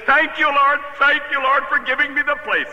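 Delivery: high-pitched, exuberant praise; giddy retold hallelujah moment; jubilant thanks. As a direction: re-enact an overjoyed thank-you prayer. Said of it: "a minister acting like that".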